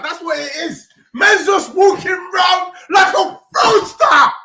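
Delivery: raging fan losing it at the team; loud furious rant; furious shouting. Real, not acted. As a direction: yell it high and loud, fed up. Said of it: "I'm pissed off".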